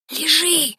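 high, urgent hissed command; frantic whisper-shout. A narrator's reading. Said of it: "страшным шёпотом закричала Женька".

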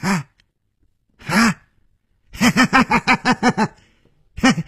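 high, bursting villain laughter; 放声大笑; loud laugh. Acted. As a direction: throw back your head and roar with laughter. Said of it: "他放声大笑".

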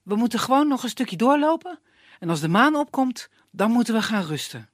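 lightly voiced youth, steady; calm her down; reassuring young man. Iskander reassuring the girl, steady voice.

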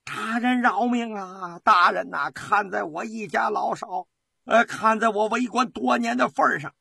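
desperate pleading howl; frantic cry. A narrator's reading. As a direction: cry out wildly, begging for your life. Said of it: "突然的狂叫起来".

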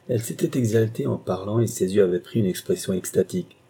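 usual speaking voice

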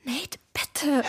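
breathy pleading whisper; urgent hushed plea. Acted. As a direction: beg him in a whisper to stop. Pleading to stop his plan.

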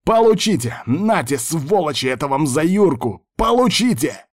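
high furious shouting; vengeful battle yell. A narrator's reading. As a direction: yell it at the enemy while firing. Gunner firing in vengeance.

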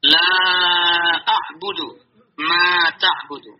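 LA drawn out long before "a'budu"; elongated recitation. Shown as a demonstration. Teacher reciting verse two to students, long vowels. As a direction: recite slowly, stretching the "la" and "ma". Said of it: "kita panjangkan lima harokat gitu".

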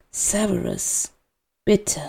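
faint, soft pleading; a dying man's soft plea; weak plea. A narrator's reading. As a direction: beg with almost no breath.

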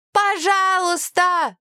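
high, drawn-out pleading call; child's cry. A narrator's reading. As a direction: cry out a long begging please. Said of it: "закричала Далия".

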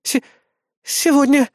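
смущённый выдох. A narrator's reading. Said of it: "выдохнула напарница".

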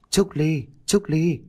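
high, repeated name call; a plaintive-pitched call without shouting; calling her name. A narrator's reading. The lost dog calls Trúc Ly at midnight, calling out. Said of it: "nghe tiếng gọi của con bò".

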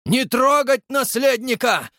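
high, slow furious roar. Acted. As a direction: bellow it out in panic. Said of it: "взревел Растопчин-старший".

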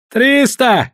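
high, loud cry; shouted bid. A narrator's reading. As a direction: fling the stake out in a shout. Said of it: "крикнул Гао".

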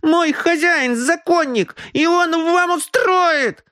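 put-on boy raging pitifully, very high; a slapped brat howling in outrage; pitiful rage. Slapped boy rages at the detective, pitiful fury. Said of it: "бушевал он в жалком гневе".

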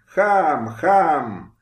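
a nobleman's scornful shouted insult; contemptuous shouts. A narrator's reading. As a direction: spit the word out like the whip cracks. Nobleman whipping a peasant, cursing.

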